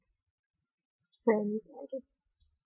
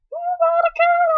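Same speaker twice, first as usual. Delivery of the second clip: very high, louder squeal of excited friends; 5 dB louder, faster than usual. Retold or imitated. Friends begging her to come along, squealing high and giddy.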